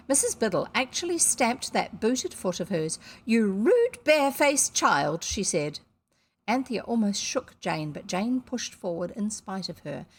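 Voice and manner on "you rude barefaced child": loud, slow scornful snarl; angry insult. A narrator's reading. Missus Biddle scolds Jane furiously. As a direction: hurl it at the child.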